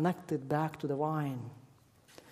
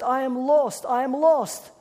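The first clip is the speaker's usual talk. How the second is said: put-on high, loud lost-child voice; a little girl voice, lost and upset; crying little girl; 9 semitones higher, 8 dB louder than usual.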